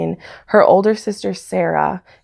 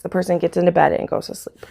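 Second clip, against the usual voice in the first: normal talking voice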